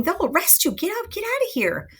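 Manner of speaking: high-pitched mock urgency; urgent warning. Friar warns Romeo of arrest, high and urgent. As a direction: squeak out a hurried warning.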